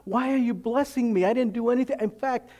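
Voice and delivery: high, amazed disbelief; can't believe you're being blessed; a stunned believer thanking God, high and amazed; overwhelmed gratitude. Retold or imitated. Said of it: "you're like".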